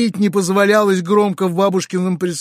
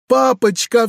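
tearful cry; 7 semitones higher than usual. A narrator's reading. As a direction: throw yourself at father crying out. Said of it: "вскричала Саша". (Саша с криком бросается к отцу)

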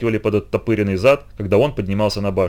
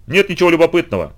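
curt cutoff; 7 semitones higher than usual. Acted. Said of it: "отрезал подошедший Бол".

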